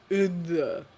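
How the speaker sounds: lightly voiced otherworldly god, flat; a hint of an ancient god. God points the way for Jake, lightly otherworldly.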